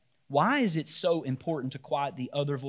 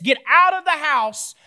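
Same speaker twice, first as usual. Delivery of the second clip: 1 yelling again; 11 semitones higher, 8 dB louder than usual. Pretend. Repeats the yell at guests.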